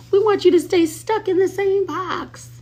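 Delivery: mocking the haters. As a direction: parody the haters in a high sneer.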